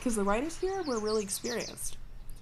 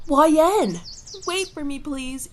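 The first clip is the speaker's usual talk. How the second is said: calling out; 7 semitones higher, 7 dB louder than usual. Acted. Chip calling after her rider.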